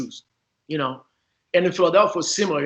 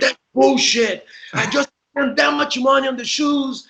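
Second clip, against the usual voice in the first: pissed-off teammate; 8 semitones higher than usual. Retold or imitated. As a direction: act out his fury over the shoes. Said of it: "he was pissed".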